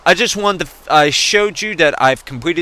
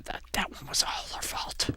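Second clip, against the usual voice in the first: whispered confession; 16 dB quieter than usual. Real, not acted. Confiding about sister's crash, hushed whisper. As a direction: whisper it like a secret.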